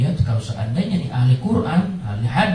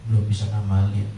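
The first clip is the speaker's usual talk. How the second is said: sad prayer; 5 semitones lower, 4 dB quieter than usual. Retold or imitated. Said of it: "ada perasaan-perasaan sedih".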